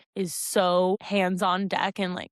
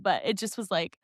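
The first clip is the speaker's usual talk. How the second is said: normal talking voice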